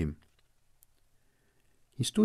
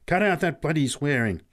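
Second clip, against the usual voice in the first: louder, higher angry scolding; angry snap; 6 semitones higher, 5 dB louder than usual. Acted. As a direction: snap at the boy. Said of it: "snapped Pat angrily".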